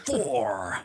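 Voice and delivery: whispered, drawn-out number call; countdown announcement. Acted. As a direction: announce the rank in a long stagy whisper. Countdown announcement between entries.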